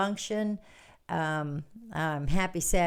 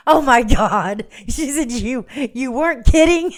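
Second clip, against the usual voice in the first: shocked disbelief; 6 semitones higher, 12 dB louder than usual. Retold or imitated. Kim stunned the joke was serious. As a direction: cry out in disbelief. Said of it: "And she goes".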